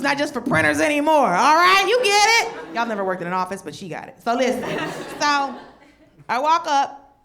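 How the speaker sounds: normal talking voice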